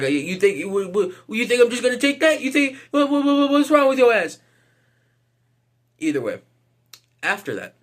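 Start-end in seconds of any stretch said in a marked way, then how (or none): 0.0-4.4 stammering, high-pitched mock outrage; Raja Jackson acting tough. Raja Jackson refusing to take a hit, mock tough and stammering. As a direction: climb higher and higher through a hammed-up challenge. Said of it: "starts acting all tough".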